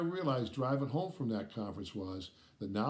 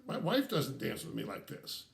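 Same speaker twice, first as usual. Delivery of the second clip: ordinary talk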